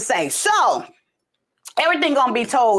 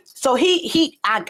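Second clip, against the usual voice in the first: ordinary delivery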